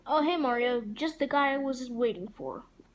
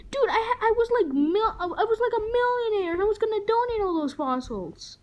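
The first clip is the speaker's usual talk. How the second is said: angry rant; 10 semitones higher, 6 dB louder than usual. Acted. Link ranting about lost fossils.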